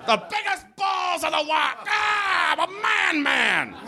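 high, hammed-up manly yelling; mock macho cheer. Comic cheering the statue horse's balls, hammed-up high yell. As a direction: bellow it like a mock he-man.

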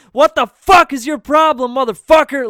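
yelling at the speeding driver, angry and loud; retold angry yell. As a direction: scream it at the driver. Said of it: "and I'm yelling".

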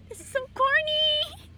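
high, slow, giggly quote through laughter; laughing while quoting. Real, not acted. Telling a friend about her boyfriend, giggling. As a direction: squeal it out, barely holding the laugh in.

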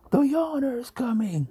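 high, loud mock-panicked cry; mock alarm. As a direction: play the crowd announcing a superhero. Voices alarm at invented superhero.

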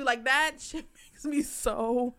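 ordinary talk

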